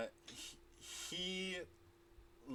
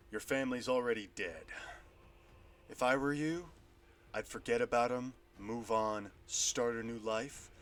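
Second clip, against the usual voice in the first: menacing warhammer man; 4 semitones lower than usual. Acted. GM voices warhammer figure.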